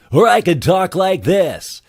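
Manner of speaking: very high, slow, loud silly voice; high, loud demo voice. Shown as a demonstration. Voice talent showing off the range, high loud voice. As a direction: push it way up and stretch it out.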